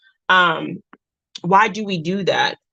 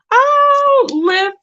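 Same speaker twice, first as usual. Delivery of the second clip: excited oh; 5 dB louder than usual. Real, not acted. Greeting a viewer who joined.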